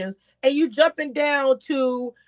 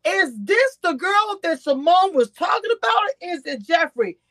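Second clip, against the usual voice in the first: retold confusion; 8 semitones higher than usual. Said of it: "I was saying".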